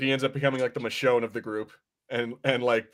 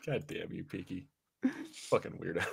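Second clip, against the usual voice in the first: much softer grumbled curse; muttered; 8 semitones lower, 15 dB quieter than usual. Real, not acted. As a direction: mutter it under your breath. Muttered aside after laughing.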